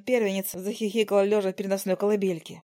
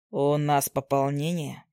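low amused husband; 6 semitones lower, slower than usual. Acted. Husband jokes to wife.

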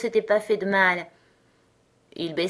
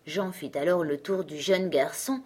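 plain reading voice

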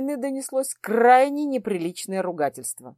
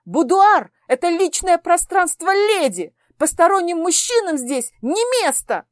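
loud, higher outraged scolding; indignant outburst; 7 semitones higher, 5 dB louder than usual. Acted. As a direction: lecture the intruders in open fury. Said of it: "воскликнула, не сдержавшись".

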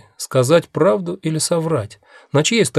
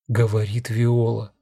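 plain reading voice

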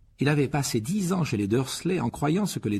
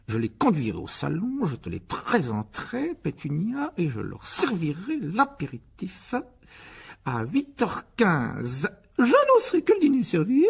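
pompous uncle rehearsing; 9 semitones higher, slower than usual. Acted. Uncle rehearsing the evening timetable, pompous and self-satisfied. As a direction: act the grand host, ending on a pleased laugh.

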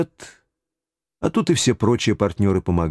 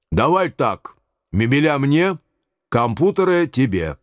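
higher, wheedling bargain; haggling official; 5 semitones higher than usual. A narrator's reading. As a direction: divide the spoils greedily.

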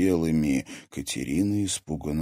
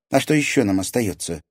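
scornful, raised-pitch retort; mocking; 7 semitones higher, 4 dB louder than usual. A narrator's reading. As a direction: scoff at the question. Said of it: "насмешливо фыркнула".

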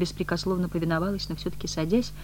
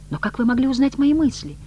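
high, louder distressed question; pained bewilderment; 10 semitones higher, 5 dB louder than usual. A narrator's reading. Woman whose thoughts were read, pained and bewildered. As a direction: plead for an explanation. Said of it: "страдальчески сморщилась".

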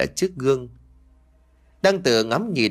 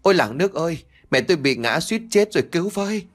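calling out for help; 5 semitones higher, faster than usual. Acted. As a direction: wail for the whole village to hear. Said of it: "kêu làng lên".

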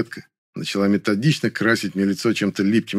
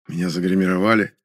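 ordinary delivery, nothing marked

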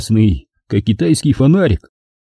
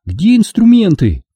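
high-pitched character question; Angela's voice; 9 semitones higher than usual. A narrator's reading. Angela asking him for tools, high voice. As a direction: ask it up high, as her.

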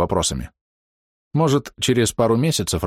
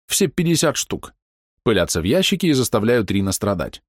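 usual speaking voice

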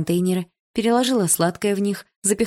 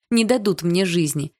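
plain reading voice

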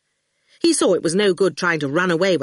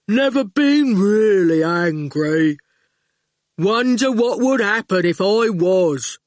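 slow, heavy-witted thug, words dropped one by one; menacing henchman taking his time; slow lumbering thug; slow goon voice; 3 semitones higher, 3 dB louder, slower than usual. Acted.

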